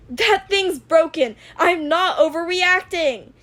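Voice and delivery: furious shout; yelling. Acted. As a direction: yell it back, clearly overreacting. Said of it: "Izuku yelled".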